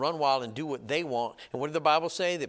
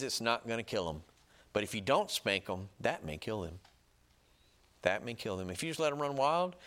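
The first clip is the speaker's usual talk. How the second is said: ordinary talk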